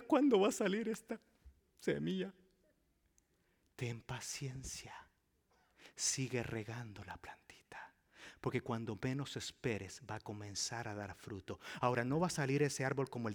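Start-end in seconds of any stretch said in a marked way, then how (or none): 0.0-2.3 mock impatient gardener. Impatient believer voiced. 3.7-11.6 quiet reassuring pastor; gentle counsel. Acted.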